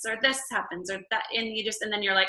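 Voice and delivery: ordinary talk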